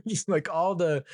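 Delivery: normal talking voice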